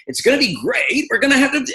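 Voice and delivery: high, hearty, welcoming bon vivant; uncle's bon vivant voice. Shown as a demonstration. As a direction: invite the son-in-law into the party. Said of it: "my uncle's voice will come out".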